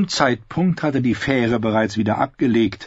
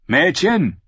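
calling out; 8 semitones higher, 4 dB louder than usual. Acted. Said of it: "rief Sir Heilin zu".

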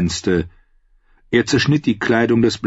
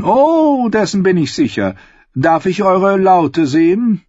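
gushing queen; 8 semitones higher, 3 dB louder than usual. A narrator's reading. Queen asks to see the bard's lute, gushing. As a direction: coo it with put-on delight.

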